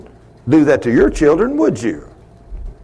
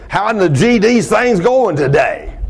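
lightly imitated cussing greeting, pitched up; foul-mouthed old customer; 7 semitones higher than usual. Old man walking up to say hello, imitated cussing. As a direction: do the old regular, higher and casually profane. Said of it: "that's the way he talked all the time".

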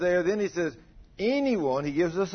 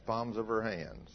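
normal talking voice

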